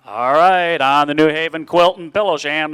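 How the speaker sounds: loud auction opening; auctioneer. Acted. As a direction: launch the bidding loudly. Student starts auction chant.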